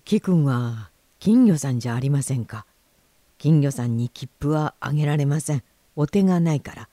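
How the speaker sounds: low-pitched official voice; station attendant. Acted. Station man refuses the goldfish.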